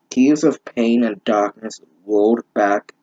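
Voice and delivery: normal talking voice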